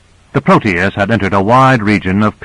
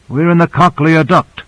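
higher-pitched, lightly voiced expert; explaining scientist; 7 semitones higher than usual. Scientist shows crew where they are, explaining.

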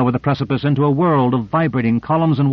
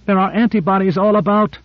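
high, alarmed cry for rescue; restrained high-pitched scream; 9 semitones higher than usual. A narrator's reading. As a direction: scream for help, pitched high without full volume. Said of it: "She screamed".

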